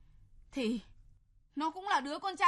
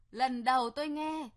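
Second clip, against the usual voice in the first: ordinary talk, nothing marked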